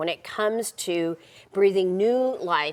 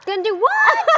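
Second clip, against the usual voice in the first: laughing mock echo; 7 dB louder than usual. Host echoes the grandmother quote.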